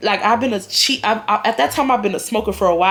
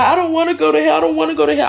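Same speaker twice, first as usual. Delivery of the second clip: high-pitched frantic mock plea; imitating panic; 7 semitones higher, 4 dB louder, faster than usual. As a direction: act out tweaking, voice pushed up high. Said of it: "I started tweaking".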